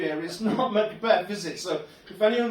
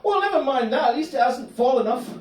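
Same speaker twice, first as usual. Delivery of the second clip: higher, louder, cheerfully dismissive; a hint of chirpy Gary; 7 semitones higher, 5 dB louder than usual. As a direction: brush off the pain with a smile, lightly voiced. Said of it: "dialogue with Gary".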